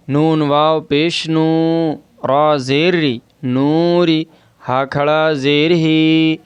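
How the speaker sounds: नून वाव पेश नू, रा जेर री, नूरी, हा खड़ा जेर ही, नूरिही spelled slowly, long vowels drawn out. Shown as a demonstration. Teacher spelling नूरिही for students, slow and drawn out. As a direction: build नूरिही letter by letter, stretching नू and ही. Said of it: "ये देखें".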